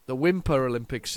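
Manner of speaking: ordinary talk, nothing marked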